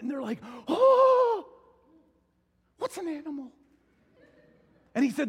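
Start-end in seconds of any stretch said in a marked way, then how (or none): 0.7-1.5 high, loud, drawn-out "oh"; hammed-up chorus of amazement; comically over-awed gasp; awestruck angels. 2.8-3.5 high-pitched baffled angel; comically awed question after a big reaction; mock wonder. Angels baffled by new creation.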